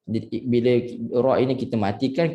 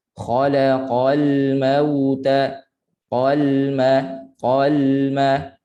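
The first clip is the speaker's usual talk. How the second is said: slow recitation, the l of "qalma" lingering; one by one; 3 dB louder, much slower than usual. Shown as a demonstration. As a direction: draw out "Khalaqal mauta" syllable by syllable. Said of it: "Sebut satu-satu". ("Khalaqal mauta" disebut satu-satu)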